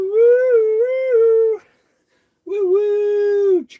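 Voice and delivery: kid-style train imitation, whistle and chug; train whistle and chugging. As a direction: play a choo-choo train, whistles blasting.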